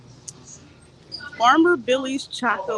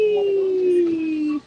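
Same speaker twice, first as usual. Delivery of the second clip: high, loud little cheer; shop-floor cheer on getting the tag; 7 semitones higher, 5 dB louder than usual. Real, not acted. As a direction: celebrate the find.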